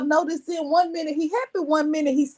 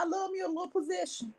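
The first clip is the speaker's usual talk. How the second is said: soft, quick, higher-pitched aside; quiet aside; 4 semitones higher, 12 dB quieter, faster than usual. Real, not acted. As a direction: slip it in softly and quickly. Muttered first take, then repeated.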